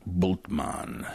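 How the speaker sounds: "Bultmann" as BOOLT-mahn; scholarly pronunciation. Shown as a demonstration. Back to scholarly discourse, "Bultmann" as BOOLT-mahn. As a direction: sober "Bultmann" with a full mahn. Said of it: "in scholarly discourse".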